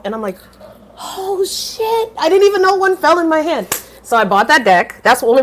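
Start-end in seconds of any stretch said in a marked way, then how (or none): 1.0-3.7 high startled realization; surprised exclamation. Retold or imitated. Narrator noticing a card fell into her hand, high surprise. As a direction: pitch up, caught off guard.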